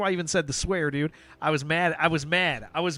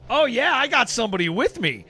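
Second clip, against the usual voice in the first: louder, higher mock brag from another player; mock gloating player; 6 semitones higher, 6 dB louder than usual. Streamer mimicking a chat troll, loud mock gloat. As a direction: play a cocky gamer who's found an ally, raised voice. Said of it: "make somebody else in the group go".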